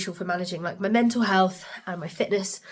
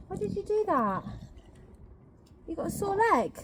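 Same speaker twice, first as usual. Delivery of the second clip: high, soft pet-talk voice; soft, pitched-up concern for a pet; fussing over a dog; 10 semitones higher, 7 dB quieter than usual. Real, not acted. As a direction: coo the questions tenderly to the dog.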